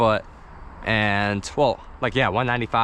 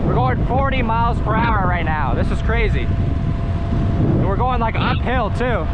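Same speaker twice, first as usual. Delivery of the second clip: excited; 10 semitones higher than usual. Real, not acted. Fast scooter speed run.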